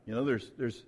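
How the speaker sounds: ordinary talk, nothing marked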